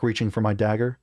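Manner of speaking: usual speaking voice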